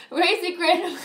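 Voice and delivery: high, loud burst of real laughter. Real, not acted. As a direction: crack up, then gasp out the name. Cracking up at chat name.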